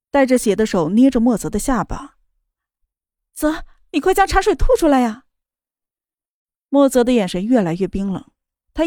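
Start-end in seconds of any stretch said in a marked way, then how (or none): 3.3-5.2 desperate pleading. Acted. Sister begs him spit tea.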